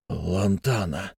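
soft, slow, disbelieving; incredulous whisper. A narrator's reading. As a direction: breathe the name in disbelief. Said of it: "неверяще прошептал Адамс".

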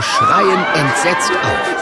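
loud, high, stretched dramatic telling; horrified narration. A narrator's reading. Narrator showing the crowd's fright, loud and drawn out. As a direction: raise the voice as if screaming along.

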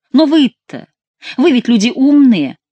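high, loud, emphatic irony; pointed mockery. A narrator's reading. Chicot calls the men clever, pointed mockery. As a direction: hit ВЫ hard and high.

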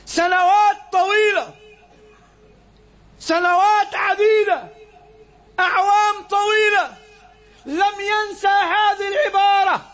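high, slow, ringing sermon climax; preaching at full force. Acted. Preacher telling the congregation he never forgot, high and slow. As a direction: lift it and draw every phrase out.

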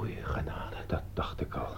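low breathless whisper; shocked whisper. Acted. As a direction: gasp it out in shock. Shocked at murder news. (geschokt gefluister)

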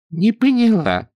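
put-on sighing old woman. A narrator's reading. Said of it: "вздохнула бабка".

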